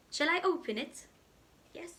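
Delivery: usual speaking voice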